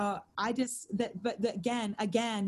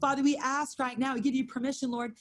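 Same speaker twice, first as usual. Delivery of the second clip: intercessory prayer to the Lord; earnest prayer; 4 semitones higher than usual. Acted. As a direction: pray it out over the room. Leads the prayer.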